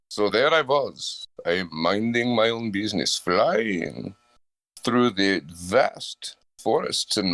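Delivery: slow, brooding mock Dracula telling his tale; Dracula monologue. For the friend, a slow hammed-up Dracula monologue. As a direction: drawl it out like a hammed-up vampire. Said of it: "set the scene where you are Dracula".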